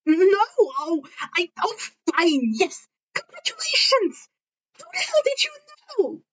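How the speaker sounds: high-pitched flustered snark; snarky Izuku. Acted. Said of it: "Izuku snarked back".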